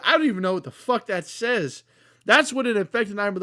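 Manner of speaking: ordinary talk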